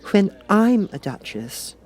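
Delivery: put-on high, slow, doubtful girl's voice; a girl musing without much hope; wistful Alice. A narrator's reading. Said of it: "not in a very hopeful tone".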